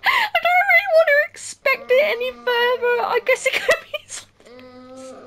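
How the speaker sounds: high, loud reluctant officer; whiny cop voice. Retold or imitated. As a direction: voice the cop as a scared whiner. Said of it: "Dude, you're a police officer".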